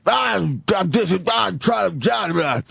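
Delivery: put-on high, garbled drunken yelling; mock a loaded man's incoherent rant; belligerent drunk. Drunk passenger ranting at the cabin, garbled belligerent yell. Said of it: "and he's belligerent".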